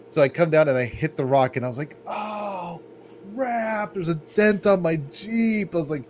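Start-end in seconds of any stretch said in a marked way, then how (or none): 2.1-5.7 high, slow groan of dismay; like spotting the first scratch on your baby; dismayed outcry. Retold or imitated. Dismayed driver seeing a dent in his new Jeep, high and slow.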